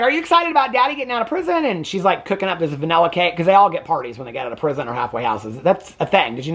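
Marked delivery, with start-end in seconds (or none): 0.0-1.6 higher, fast, sing-song question; chirpy mom. Retold or imitated. Mother quizzing her daughter about Daddy, fast high sing-song. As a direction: rattle it off bright and quick to a kid.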